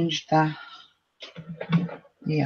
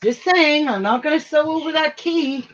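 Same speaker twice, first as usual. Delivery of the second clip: teasing; 9 semitones higher than usual. Real, not acted. Repeats the teasing remark about the key.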